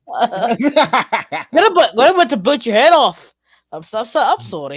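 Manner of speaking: exaggerated Canadian "about"; broad mock-Canadian apology; Canadian accent. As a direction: play a very sorry Canadian monster. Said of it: "No, no, it's a Canadian".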